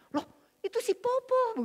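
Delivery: shocked surprise. Retold or imitated. Yuli opening the door to find her mother, high shocked cry. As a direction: gasp it out at the door. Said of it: "kaget luar biasa".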